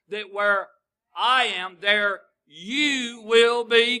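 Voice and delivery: loud, very slow, stretched out; preached climax. Acted. Preacher to congregation, quoting Jesus, loud and drawn out. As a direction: ring out the promise to the congregation.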